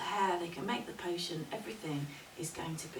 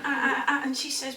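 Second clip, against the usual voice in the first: usual speaking voice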